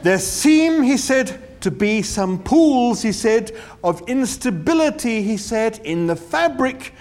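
slow, high, spelled-out patience; slow and distinct. A narrator's reading. Ford lecturing Arthur as if to a dim clerk, slow and distinct. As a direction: talk as if to a clerk, slowly, each phrase apart. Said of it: "as slowly and distinctly and patiently".

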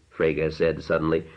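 ordinary delivery, nothing marked

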